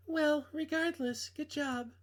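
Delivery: a hint of a young woman on the phone; girl voice. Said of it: "I can't do girl voices".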